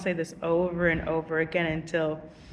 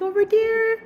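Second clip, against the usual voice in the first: put-on much higher, childlike answer; little kid voice; 5 dB louder than usual. To a teacher asking about her shoes, little kid voice. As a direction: sound like a small child caught out. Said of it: "I said, like, a little kid voice".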